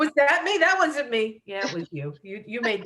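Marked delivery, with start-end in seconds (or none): 0.0-1.4 disbelief. Retold or imitated. Inner voice in denial.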